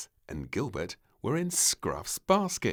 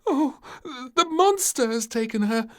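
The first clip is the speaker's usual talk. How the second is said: high, loud frightened voice; shakily; 11 dB louder than usual. Acted. Maid telling the king and queen, shaky and scared. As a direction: tremble with fear of the monster. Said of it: "said the maid shakily".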